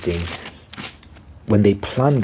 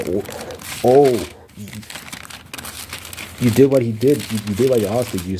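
angry Samuel; 4 semitones higher than usual. Retold or imitated. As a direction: let the prophet explode at the king. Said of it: "So, so Samuel's pissed".

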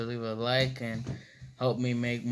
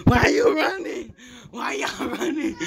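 excited; 10 semitones higher, 6 dB louder than usual. Real, not acted. Chasing during game.

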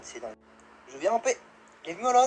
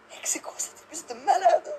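whispered laughing disbelief; 10 semitones higher than usual. Real, not acted.

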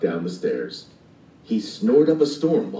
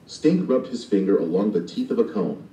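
ordinary delivery

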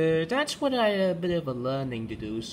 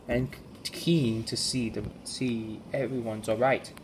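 ordinary delivery, nothing marked

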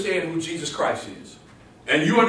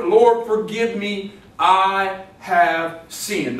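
very drawn-out, deliberate prayer; solemnly stretched I HAVE SINNED; slow confession; much slower than usual. Acted. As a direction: weigh each word, pausing between them. Repeats prayer as continual confession.

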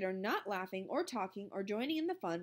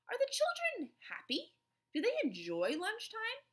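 gentle question; 13 semitones higher than usual. A narrator's reading. Principal asking gently.

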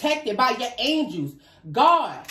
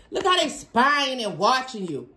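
ordinary delivery

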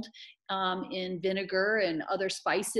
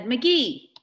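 loud, higher, emphatic announcement; said aloud; 6 semitones higher, 9 dB louder than usual. Acted. Amanda passes the surname on to her mother, said aloud. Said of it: "She said".

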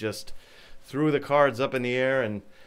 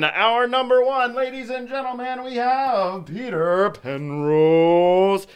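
higher, building game show hype; hyped announcer; 9 semitones higher, 3 dB louder than usual. Shown as a demonstration. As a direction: announce it like a big reveal. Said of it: "higher hyped second take".

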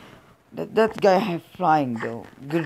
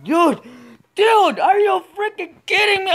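loud, much higher furious shout; a loud high outraged yell at the screen; exasperated yell; 6 dB louder than usual. Real, not acted. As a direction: scream it in disbelief at the game. Frustrated gamer outburst.

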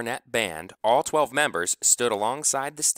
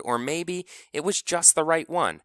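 usual speaking voice

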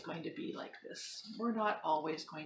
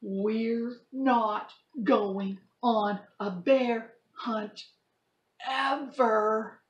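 lively storybook read-aloud; reading to children; 5 semitones higher, 12 dB louder than usual. Acted. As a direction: act the story out for kids. Teacher reads picture book to class.

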